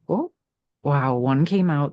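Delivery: ordinary talk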